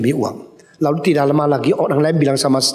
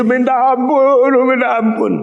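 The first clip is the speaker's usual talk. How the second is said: begging for mercy; 8 semitones higher, 4 dB louder than usual. Retold or imitated. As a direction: beg like a caught thief. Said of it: "dia nangis berlutut".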